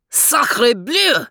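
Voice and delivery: put-on cried out high in a French accent; a startled French dog crying out; French exclamation. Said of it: "cried Max".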